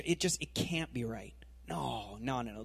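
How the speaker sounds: breathy hushed no no no; whispered inner protest. Retold or imitated. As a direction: believer whispering resistance to Jesus in their head.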